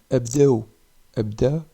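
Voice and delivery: abdeu said loud with the final ou stressed; teacher models abdeu for a group; abdeu with added ou. Shown as a demonstration. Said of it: "J'ajoute le ou ici".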